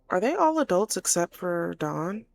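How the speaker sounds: ordinary delivery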